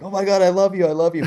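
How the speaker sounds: high, loud mock-gushing superfan; gushing fanboy. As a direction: ham up a starstruck fan squealing at his idol. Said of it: "that huge fanboy that's like".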